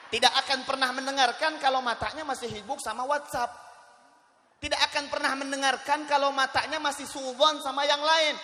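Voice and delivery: loud, high-pitched preacher's delivery; full-force preaching. Real, not acted. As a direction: drive it out loudly to the whole congregation.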